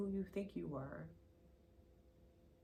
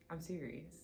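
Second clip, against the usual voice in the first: usual speaking voice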